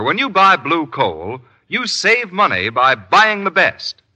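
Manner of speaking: normal talking voice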